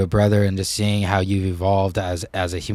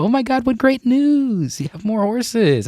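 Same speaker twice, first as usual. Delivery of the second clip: very high, drawn-out townsfolk gushing; mock excitement; 3 dB louder, slower than usual. Townspeople congratulating farmer on more horses, mock excitement.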